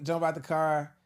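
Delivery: ordinary delivery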